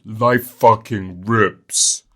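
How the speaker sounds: loud, slow comic delivery; comedian catchphrase. Retold or imitated. Mimicking a comedian's catchphrase, loud and slow. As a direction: drag it out like a stand-up punchline. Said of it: "some comedians do catchphrases".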